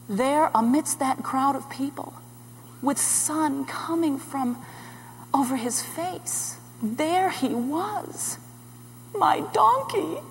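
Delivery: slow, soft, dawning awe; slow right down in amazement; softly marvelling, with pauses; hushed wonder. Acted. Awed sight of the donkey.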